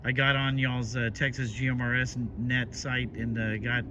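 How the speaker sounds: walkie-talkie. Real, not acted. Radio contact recorded from walkie-talkie.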